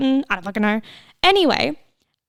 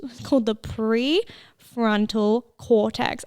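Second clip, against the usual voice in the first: slow careful retry; slower than usual. Shown as a demonstration. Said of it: "I just can't fucking speak".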